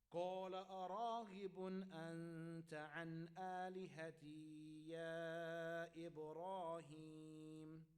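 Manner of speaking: slow, melodic chanted recitation; Quran recitation. Acted. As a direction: recite it like tilawah, drawing out the vowels. Recites the father's reply in Quran.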